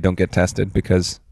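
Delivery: normal talking voice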